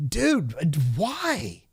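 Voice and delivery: high, disbelieving plea; mock-exasperated high-pitched why; incredulous dude. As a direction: ask in baffled disbelief why they talk that way. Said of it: "you're like".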